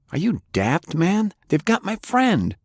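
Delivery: high, exasperated protest; indignant outburst. Acted. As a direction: push back hard, can't believe it. Francis angrily protests danger warning.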